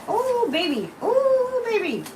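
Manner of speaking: thrilled shriek; excited. Real, not acted. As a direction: squeal it out with glee. Excited exclamation at card.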